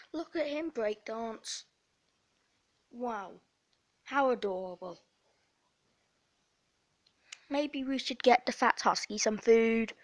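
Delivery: ordinary talk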